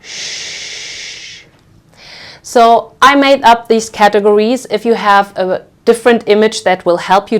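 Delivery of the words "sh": sh as a long voiceless hush; sustained sh sound. Shown as a demonstration. Teacher demonstrates finger-on-lips sound, sh held long and voiceless. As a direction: hold sh like shushing someone. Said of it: "this represents the sound of".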